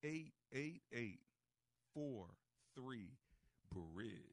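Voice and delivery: slow, deliberate, each digit spaced; over enunciating. Shown as a demonstration. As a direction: spell the number out slowly, digit by digit. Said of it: "I'll say it slower and I'll use the acronym".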